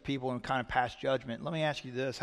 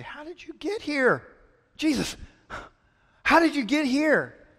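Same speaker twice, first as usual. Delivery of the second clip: much higher, louder, amazed; pitched-up astonished crowd; astonished disciples; 13 semitones higher, 8 dB louder than usual. Acted. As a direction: ask it like you can't believe he's there. Disciples ask Jesus, astonished.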